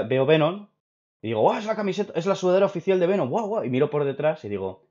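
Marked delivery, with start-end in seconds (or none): 1.4-3.6 high-pitched, awestruck gushing; gasp-of-wonder excitement, voice way up; excited wow. Retold or imitated. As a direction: act out being thrilled by a surprise gift. Acting out his delight at the gift.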